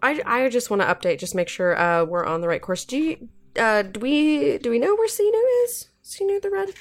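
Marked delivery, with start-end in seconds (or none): 2.8-6.7 high, feigned innocent question. Acted. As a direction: pretend you didn't forget.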